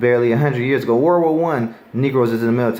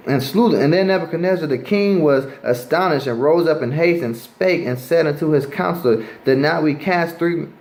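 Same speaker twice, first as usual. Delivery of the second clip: a raised, deliberate Bible reading voice; preacher reading scripture to the street crowd; 4 semitones higher than usual. Acted. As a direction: read the King James verse aloud with weight.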